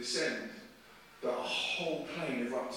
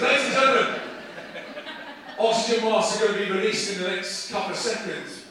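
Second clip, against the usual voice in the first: loud, raised intercom call; imitated pilot announcement; 6 semitones higher, 9 dB louder than usual. Preacher acts the pilot announcing oxygen masks, loud and urgent. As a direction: project it like an urgent cockpit announcement.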